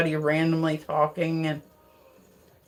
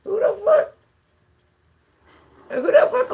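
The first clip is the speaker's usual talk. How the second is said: startled outburst; 13 semitones higher, 5 dB louder than usual. Retold or imitated. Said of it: "and I'm like".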